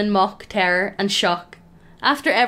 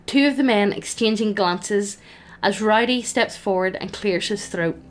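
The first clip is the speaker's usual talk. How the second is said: ordinary delivery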